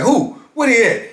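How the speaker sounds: a live wire flaring up at the news; hot-headed outburst. Retold or imitated. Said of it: "Wop is a, a live wire".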